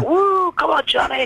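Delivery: high-pitched mock crowd cheer; imitated cheering. Rugby crowd greets Johnny on stage, mock cheering. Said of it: "like, cheering".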